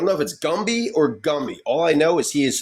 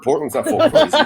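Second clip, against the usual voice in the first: sarcastic; 13 semitones higher, 5 dB louder than usual. Real, not acted. Sarcastic joke.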